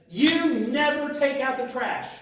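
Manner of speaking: high, loud, slow angry outburst; hammed-up furious wife yelling at husband; mock angry wife snapping at husband over trash. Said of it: "Now, she's angry".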